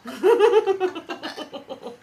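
fast, laughing through words. Real, not acted. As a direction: laugh it out over the joke. Joking, laughing.

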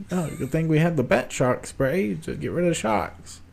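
campy sidekick. Acted. Host acting out the shark scene, slow campy voice. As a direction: play a corny superhero scene slowly.

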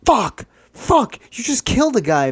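high-pitched, horrified cursing; acted panic. Friend seeing he just killed a guy, panicking. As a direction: let it jump up high in alarm.

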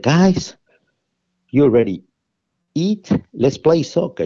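put-on high, bright call to a group of children; rally the kids to go play, pitched well up. Calling out to the kids.

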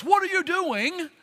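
mildly acted indignant challenge, pitched high; Job. Job challenges God over his suffering, indignant and high. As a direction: imitate an aggrieved man's demand. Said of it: "God responding to Job's".

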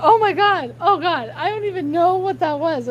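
high, flustered mock horror; imitated dismay. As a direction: mimic a friend appalled at himself. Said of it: "then you're like".

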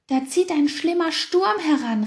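high, loud alarmed call. A narrator's reading. Hare calling out a storm warning, high and loud. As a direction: warn everyone of the storm. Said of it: "rief er".

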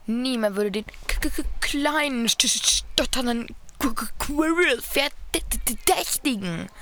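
stammering and halting, stretching each word; stuttering voice. Retold or imitated. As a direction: stutter nervously through the name. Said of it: "nochmal in dieser Stotterstimme".

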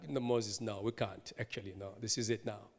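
low, soft, final refusal; God refusing. Acted. God tells Moses no this time, quietly and firmly. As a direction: drop down quietly and close the matter.

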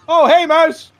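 high, loud laughing guard greeting; cheery guard. Acted. Guard at the gate hails Mouse, high and bright with a laugh. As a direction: sing out a surprised hello. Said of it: "the guard guy goes".

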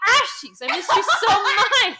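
high, loud, slow hammed-up longing; mock dramatic character. Quoting game characters pining for Ass Cheeks, hammed-up and laughing. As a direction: ham up a lovesick game character.

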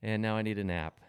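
ordinary talk

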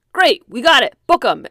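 loud, excited cop cheering; eager cop. Retold or imitated. Cop celebrating the arrest, loud and eager. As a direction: shout it like a cop who just cracked the case. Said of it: "one of the cops is like".

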